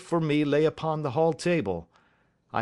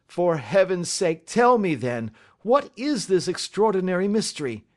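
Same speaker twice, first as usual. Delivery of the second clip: higher, forceful pleading; urgent plea; 5 semitones higher, 4 dB louder than usual. Acted. Banker begging Holmes to explain the mystery, urgently. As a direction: beg for the answer, voice raised.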